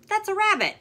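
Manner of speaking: high, loud indignant correction; high, loud storybook squabble; indignant insistence. Acted. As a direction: set them straight, loudly. Characters arguing in book.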